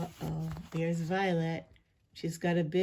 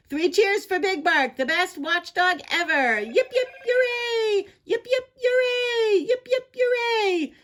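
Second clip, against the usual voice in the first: very high, loud crowd hurrah; shrieking jubilant cheer; 13 semitones higher, 6 dB louder than usual. Acted. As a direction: lead three cheers at full squeal.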